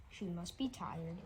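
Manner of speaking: ordinary delivery, nothing marked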